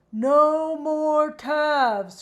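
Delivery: long drawn-out loud wail; crying out. Acted. Coco protesting to Kate about calves, loud slow wail. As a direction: wail the words slowly like a dismayed horse. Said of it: "cried Coco".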